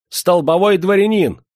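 very high, loud, puffed-up announcement; shrieked boast. A narrator's reading. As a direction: squeal the title in outrage. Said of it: "истошно взвизгнул проезжий". (визгливо, спесиво выкрикнуть титул)

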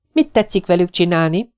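put-on high, wondering little girl's voice; curious girl. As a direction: ask wide-eyed. Little girl asks, wide-eyed. (magas, csodálkozó kislányhang)

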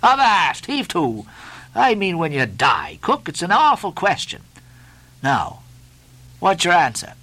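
emphatic halt, then mock-grave questioning; Stubb, hectoring mate. A narrator's reading. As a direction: halt the cook and press him.

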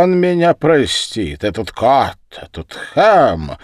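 loud, high-pitched contempt; insulting outburst. Acted. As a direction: hurl the insults, drawing out the last one. Drunken insults in a quarrel.

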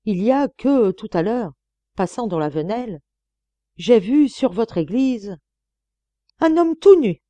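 clipped report building to a loud, high cry on the last words; nun bursting out with shocking news; curt, shocked. Acted. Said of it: "très brève".

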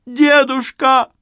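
high, loud, slow wail; desperate scream. Acted. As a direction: scream his name in despair. Yulia screaming.

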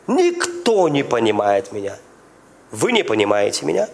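ordinary delivery

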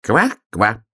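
high croaking "kva-kva"; frog croak as bark. Acted. Little frog shows the hare its bark, croaking.

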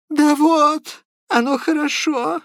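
a sick, muddled man insisting on his point; high-pitched, agitated rambling; Prokharchin's voice. A narrator's reading. Prokharchin's pleading speech.

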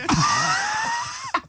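high squealing laugh; high shrieking laugh. Real, not acted. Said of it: "Did I do it again?".

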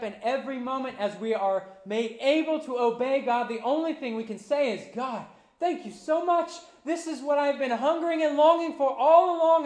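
rising, loud preacher's cry; full-force preaching. Acted. Pastor to congregation, preaching at full force. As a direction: lift the room toward the table. Preacher voicing grateful prayer.

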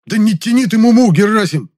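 loud, high-pitched growled demand; impatient roar. A narrator's reading. Man roars at stalling friend Герасим, impatient. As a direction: roar at him to stop stalling. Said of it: "и рыкнул я".

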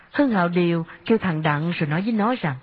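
usual speaking voice

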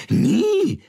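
shrieked very high and loud in fury; furious. A narrator's reading. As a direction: spit it out in a rage. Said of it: "sagte die Königin voller Wut".

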